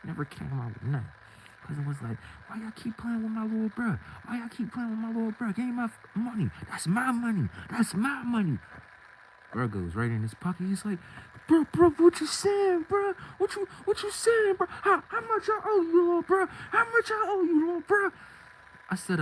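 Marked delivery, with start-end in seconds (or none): 2.5-8.6 raised-pitch demand for the money; lightly voiced pushy cousin. 11.5-18.1 much higher and louder, frantic begging; panicked squeaky pleading. Retold or imitated. Scared guy asking what he owes.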